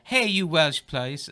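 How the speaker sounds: hammed-up Italian threat; Italian accent. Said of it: "I won't do the accent".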